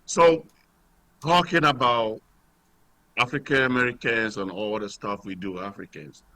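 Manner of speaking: caller on a phone line; thin, distant phone-line voice; radio show caller. Real, not acted.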